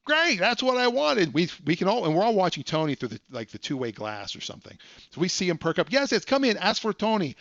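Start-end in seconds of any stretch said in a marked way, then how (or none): 0.0-1.3 high, loud, slow put-on delight; delighted prank caller. Prank caller wants stick shift. 5.9-7.3 high, eager salesman rush; can't wait to close, pitched way up; super excited. Retold or imitated. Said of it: "Tony's just super excited".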